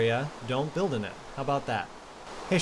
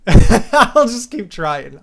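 laughing loudly through the words, slow; 8 semitones higher, 16 dB louder, much slower than usual. Real, not acted. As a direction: crack up while saying it. Laughing at own mispronunciation.

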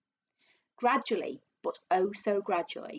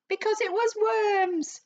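much higher, louder, slow triumphant crowing; gleeful cry; 13 semitones higher, 6 dB louder, slower than usual. A narrator's reading. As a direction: gloat it out like a cackling old woman. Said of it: "cried Missus Twit".

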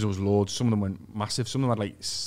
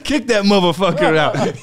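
put-on loud, slow American accent, still laughing; a laughing mock-American bouncer order; fake American; 7 semitones higher, 10 dB louder, slower than usual. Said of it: "Why did you turn American?".

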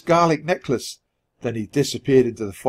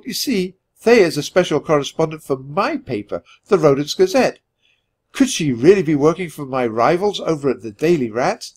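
plain reading voice

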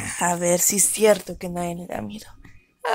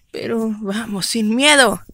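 ordinary delivery

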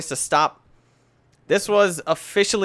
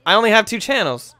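higher, louder protest; indignant outburst; 7 semitones higher, 7 dB louder than usual. Real, not acted. Gamer finding only two TV channels, loud indignant outburst. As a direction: protest it loudly in disbelief.

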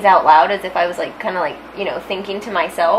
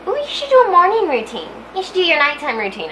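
high, chirpy mock suggestion; mimicked advice-giver; 8 semitones higher than usual. People urging a routine on the speaker, mockingly high. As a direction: pitch it up to imitate pushy advice.

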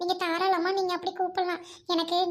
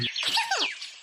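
high, amused laugh bursting out; burst of laughter; 12 semitones higher than usual. Acted. Laughing at the man bathing in the road, a high amused burst.

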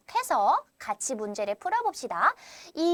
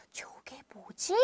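hushed whispered question; whispered wondering; 14 dB quieter than usual. Acted. Teacher wonders what the thing is, in a whisper. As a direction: whisper it like a puzzled aside.

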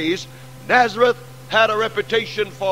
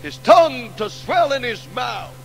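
hushed sermon narration; 5 semitones lower than usual. Acted. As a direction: draw the congregation in close with a falling voice. Describes crucifixion, voice sinking.

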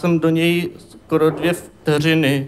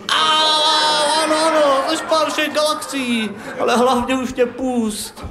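high squealing surrender; whiny boy in pain; 10 semitones higher than usual. Acted. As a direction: yelp and give in fast. The boy begging to be released.

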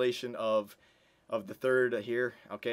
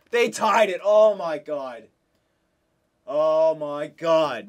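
dismayed disbelief; 8 dB louder, much slower than usual. Real, not acted. Opponent ties the game.

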